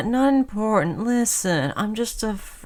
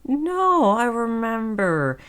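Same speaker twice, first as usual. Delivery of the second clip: sudden recognition; 7 semitones higher than usual. Acted. Sudden recognition of old acquaintance.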